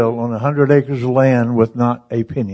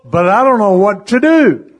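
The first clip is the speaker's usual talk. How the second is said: higher protest, pitch climbing on "to do"; retold frustrated protest; 5 semitones higher, 4 dB louder than usual. Husband answering his reassuring wife, frustrated protest. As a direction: imitate a husband at a loss.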